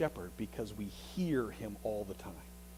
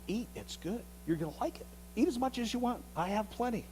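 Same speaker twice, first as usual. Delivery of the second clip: tempting stranger voice; 8 semitones higher than usual. Acted. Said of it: "the accent's different".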